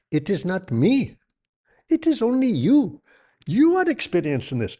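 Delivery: mock guru voice. Fake guru addressing followers.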